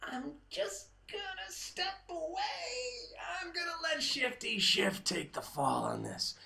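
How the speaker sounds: high, put-on sly voice; mock Nadler, smug and scheming; lightly voiced Nadler stepping aside; imagined Nadler quote. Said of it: "Noah Nadler sat back and said".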